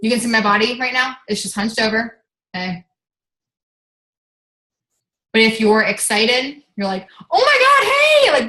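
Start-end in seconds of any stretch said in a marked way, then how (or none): 2.5-2.8 a lower, softer "Hey." with no energy; flat bad-mood hey. Shown as a demonstration. Showing a bad-mood greeting, low and grudging. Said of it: "this is my way of". 7.3-8.5 much higher, louder "Oh my God, hey!" shouted; excited greeting. Shown as a demonstration. Showing the team how excitement sounds, shouting high and loud. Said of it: "my voice goes higher".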